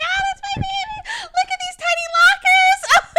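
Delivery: put-on high, loud, laughing squeal over her baby; a mother gushing over tiny lockers, high and loud with laughter; teary mom squeal. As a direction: squeal it out, half laughing, half crying. Mom tearful at preschool signup.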